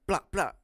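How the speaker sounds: two loud, high gunshot pops; imitated shots. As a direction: fire off two sharp shot noises.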